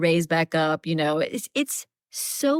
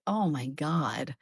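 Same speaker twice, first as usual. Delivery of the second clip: low, slow and much softer; hushed awe; 4 semitones lower, 8 dB quieter, slower than usual. Retold or imitated. Recalling her last line from the book, hushed awe. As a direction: breathe it out quietly, overawed.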